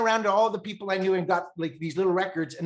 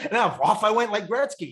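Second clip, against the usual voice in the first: laughing proudly; 6 semitones higher, 5 dB louder than usual. Real, not acted.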